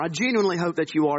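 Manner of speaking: ordinary delivery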